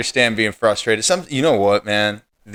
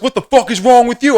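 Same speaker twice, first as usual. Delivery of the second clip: much higher, louder retold yell; imitating angry driver; 12 semitones higher, 5 dB louder than usual. The driver shouting at the pedestrian who flicked his window, angry. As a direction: throw the driver's shout back at him.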